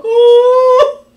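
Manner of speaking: loud, high drawn-out bawl; hammed-up sobbing howl; mock wail. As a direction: burst out wailing, imitated. Re-enacts bursting into tears.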